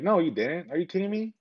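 high-pitched, emphatic contradiction; retold coach refusing to hear it; incredulous reassurance. Coach contradicts his tearful wrestler.

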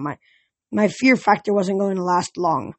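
plain reading voice

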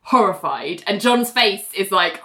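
ordinary talk

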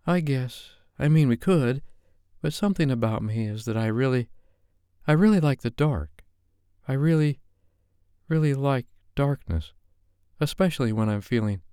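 a shy woman begging, tripping over her words; nervous Sophie. Acted. Flustered Sophie asking Dave to turn off the lights.

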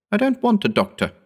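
high-pitched, stubborn refusal; wounded man's high protest; protesting neighbour. Acted.

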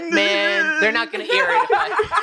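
high, anxious half-laugh; nervous. Retold or imitated. Sweating interviewer hedging, high and nervous. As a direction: squirm and hedge nervously.

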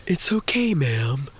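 hushed, airy musing with odd pauses; spacey lift driver. Retold or imitated. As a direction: imitate a gentle spaced-out driver, pausing between thoughts. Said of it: "he was definitely on some other shit".

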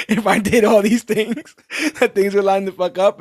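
giggling while venting; laughing. Real, not acted. Laughing while retelling a complaint.

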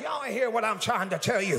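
usual speaking voice

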